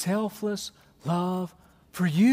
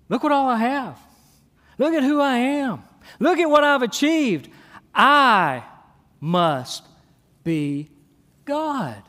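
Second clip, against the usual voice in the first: boastful pride; 5 semitones higher, 4 dB louder than usual. Pretend. Boastful self-worshipper quote.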